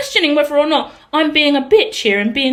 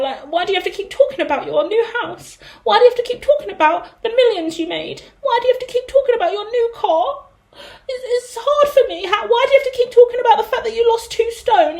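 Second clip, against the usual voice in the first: whiny complaint; 8 semitones higher than usual. Acted. Mock whiny rant at a friend.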